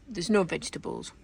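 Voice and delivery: ordinary talk, nothing marked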